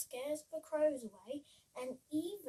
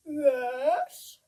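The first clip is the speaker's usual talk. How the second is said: loud, drawn-out cow moo; the cows answer with a loud moo; 14 dB louder than usual. Acted. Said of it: "mooed the cows".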